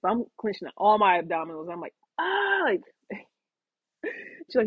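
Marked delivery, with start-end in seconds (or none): 2.2-2.6 pained cry. Retold or imitated. Tube pulled from his oblique.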